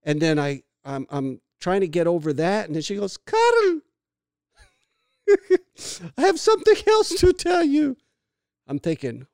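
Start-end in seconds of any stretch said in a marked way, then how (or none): 3.2-8.0 put-on high, loud Hungarian-accented woman's voice; Hungarian mother. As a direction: imitate your Hungarian mom, pitched way up and loud. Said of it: "saying it like a Hungarian lady".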